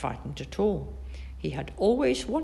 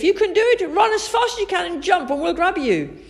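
high, loud encouragement; urgent calling; 14 semitones higher, 6 dB louder than usual. A narrator's reading. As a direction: cheer a friend on urgently. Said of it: "called Biff".

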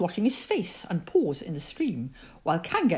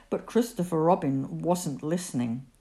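plain reading voice

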